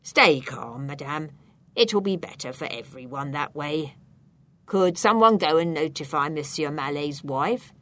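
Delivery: low, controlled official voice; calm, firm policeman. A narrator's reading. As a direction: take charge like an officer, keeping calm. Policeman calms the granny.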